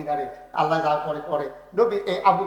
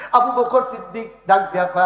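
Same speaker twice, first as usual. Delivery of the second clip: ordinary talk, nothing marked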